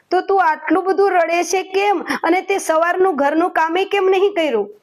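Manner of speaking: mild mock scolding husband; exasperated husband. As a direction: demand why the chores were skipped. Husband questions crying wife.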